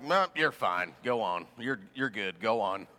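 low, unbothered small-town doctor; dismissive country doctor. Retold or imitated. Backwoods doctor waving off an injured patient, low and dismissive.

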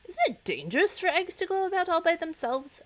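timid. Acted. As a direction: ask it shyly, a touch worried. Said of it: "asked Coochie timidly".

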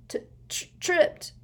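stuttering start, then a high blurt; frightened son stammering to his father; shaky stammer. Acted. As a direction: stammer it out, shaking. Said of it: "said Dudley shakily".

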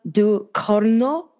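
Ducorno drawn out slowly with Italian vowels; Italian accent. Shown as a demonstration. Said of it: "I'm trying to pronounce that in an Italian accent".

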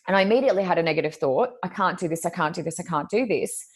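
usual speaking voice

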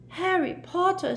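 high squeaky elf voice; squeaked. A narrator's reading. Dobby answers Harry in a high squeak. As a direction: squeak it out like a tiny eager elf. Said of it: "squeaked Dobby".

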